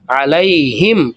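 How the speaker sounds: علیہم drawn out slowly with a clear throat ayn; slow, stretched علیہم; slow ayn demo. Shown as a demonstration. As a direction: spell out علیہم for students. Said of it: "عین کی آواز کا تلفظ جو ہے".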